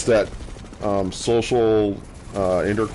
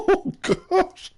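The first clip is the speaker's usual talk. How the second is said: high, squeaky laughing words. Real, not acted. Gamer still cracking up at own mistake, laughing high.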